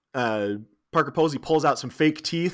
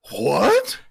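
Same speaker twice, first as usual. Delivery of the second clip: mock shocked outrage; 4 semitones higher, 5 dB louder than usual. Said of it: "Agent Kelly's just like".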